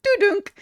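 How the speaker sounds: high imitated phone-hanging-up "doo-dunk"; hang-up noise. Acting out hanging up on the caller, high imitated noise. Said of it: "And I hung up the phone".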